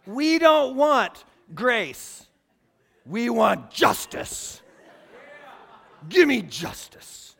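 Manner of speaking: very slow, loud, every word hammered; preacher pressing the point about justice, slow and forceful; emphatic declaration. Real, not acted. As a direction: drive each word home from the pulpit. Preaching climax.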